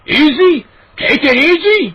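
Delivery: high, loud outraged shriek. A narrator's reading. Neighbor shrieking back at Dad, high and loud. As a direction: fling the words back in disbelief. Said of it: "Mister McCall shrieked".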